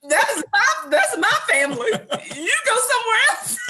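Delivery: loud, high-pitched protest through laughter; hammed-up territorial outrage, laughing; mock upset. Wife retells claiming her family from husband, laughing mock upset. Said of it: "I really got upset".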